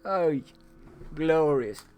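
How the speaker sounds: drawn-out rural Norfolk lilt; mock Norfolk accent. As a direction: ham up a Norfolk country accent. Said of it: "that went Norfolk there".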